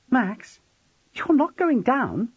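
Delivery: high, anxious question; alarmed. A narrator's reading. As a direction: plead in disbelief, pitched right up. Frightened woman grips his arm.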